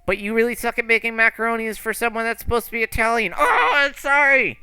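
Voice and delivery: needle, then wail a mock apology; bratty kid apologizing. Kid insults her macaronis, then wails sorry.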